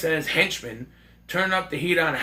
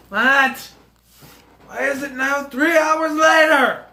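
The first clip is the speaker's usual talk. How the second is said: shocked shout; 10 semitones higher, 7 dB louder, slower than usual. Real, not acted. Realizes movie ran three hours.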